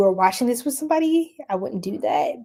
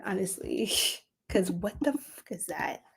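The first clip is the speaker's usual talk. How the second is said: whispered aside; 10 dB quieter than usual. Real, not acted. Whispered aside about the person.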